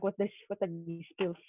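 ordinary talk, nothing marked

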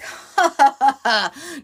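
high, loud fake laugh; put-on scoffing ha-ha; mocking laugh. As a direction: laugh dismissively in an imagined reply. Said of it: "rather than, like, saying".

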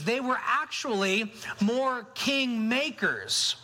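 usual speaking voice

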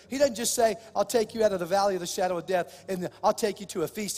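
loud, high, driving sermon voice; full-throated pulpit climax; preaching at full force. Acted. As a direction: lift the room, preach it out.

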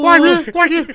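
high, loud squeaky pet voice; dog voice. Pretend. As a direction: answer as the little dog. Dog answering in skit.

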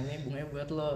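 retold shy offer. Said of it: "Dia bilang".

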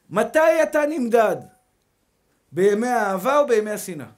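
slow, loud rhetorical questions; preaching at full force. Real, not acted. Preacher asks his brothers loudly, slowly, stretching every word. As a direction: hammer each question home to the crowd.

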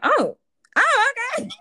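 high, squeaky mock surprise in a Cockney-free voice. Friend mocks women surprised by a polite rejected guy, high mock surprise. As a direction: feign being stunned that he took it well.

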